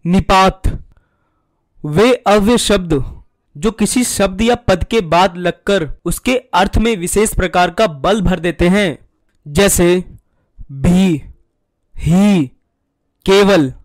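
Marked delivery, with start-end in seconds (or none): none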